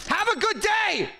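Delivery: high, sweet customer-service farewell; sing-song shopkeeper. Acted. Shopkeeper sends off a customer in a high chirpy voice. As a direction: chirp it like a cheery cashier.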